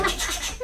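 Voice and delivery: unvoiced ch-ch buzzing with a laugh; whispered vibration buzz. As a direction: mimic the seat rumbling. Imitating seat vibration. (zumbido susurrado riendo)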